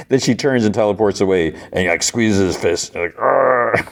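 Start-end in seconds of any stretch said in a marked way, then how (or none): 3.2-3.9 higher put-on angry "argh"; drawn-out, hammed-up growl of frustration; mock growl. Betrayed Apocalypse squeezes his fist, mock growl.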